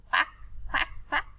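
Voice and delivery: high imitated quacking; duck quacks. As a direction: quack like a little duckling. Teacher imitates duckling sounds.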